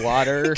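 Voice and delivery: "Water" with a clearly sounded R at the end; lifted, emphatic R ending on "Water"; pronounced R. Shown as a demonstration. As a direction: say "Water" loud, landing hard on the R. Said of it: "You gotta get that R".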